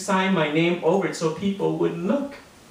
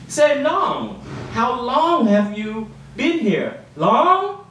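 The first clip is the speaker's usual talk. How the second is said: put-on high, jeering kid voice; high mocking pun on Long; 8 semitones higher, 4 dB louder, much slower than usual. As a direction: taunt the name like a schoolyard bully. Kids mocking the name Long.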